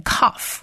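"cough" starting with a hard k; "cough" as the dotted Hebrew letter, k-initial; dotted letter pronunciation. Shown as a demonstration. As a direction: say "cough" slowly with k, not h. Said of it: "有一个黑点的时候".

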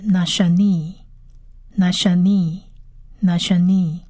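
"nashani" slowly as na-sha-nee; model pronunciation. Shown as a demonstration. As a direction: repeat "nashani" with spaces, last vowel held. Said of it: "第二个字是".

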